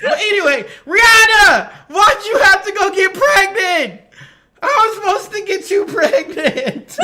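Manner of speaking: high, loud wail of complaint; hammed-up jilted lover shouting; mock outrage. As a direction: cry out in pretend betrayal, half laughing. Mock complaint shouted to absent star.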